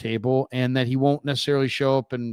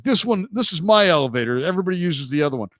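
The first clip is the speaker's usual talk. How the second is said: Howard explaining; 5 semitones higher, 3 dB louder, faster than usual. Retold or imitated. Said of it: "he's like".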